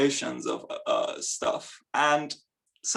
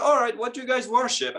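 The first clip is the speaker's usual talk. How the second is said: breezy Roman; 9 semitones higher, faster than usual. Retold or imitated. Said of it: "They were like".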